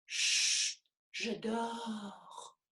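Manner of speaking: hushed sleepy whisper; sleepy thumb whisper. Pretend. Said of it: "monsieur Pouce".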